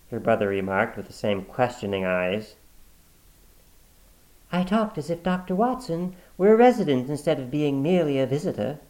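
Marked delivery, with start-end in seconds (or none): 4.5-8.8 high, light woman's reply. Acted. Sister covering with her brother, smoothly. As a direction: answer smoothly.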